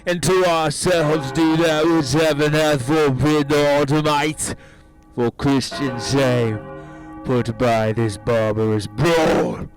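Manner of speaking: loud, measured authority; commanding rebuke. Acted. General shames his brawling soldiers, loud commanding rebuke. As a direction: thunder a stern public rebuke.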